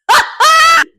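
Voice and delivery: loud high laugh; real shrieking laugh; high burst of laughter. Real, not acted. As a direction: cackle with delight. Bursts out laughing after quote.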